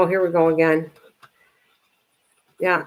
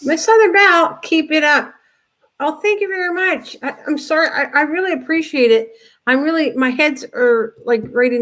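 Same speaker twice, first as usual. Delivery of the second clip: much higher, louder delighted thank-you; gushing thanks; 9 semitones higher, 4 dB louder than usual. Real, not acted. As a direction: gush over the donation. Thanking a viewer for donation.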